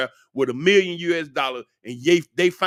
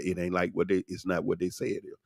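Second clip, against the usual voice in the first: confidential hush; 9 semitones lower, 8 dB quieter, faster than usual. Retold or imitated. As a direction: lower and softer, leaning in close. Came up real close to me.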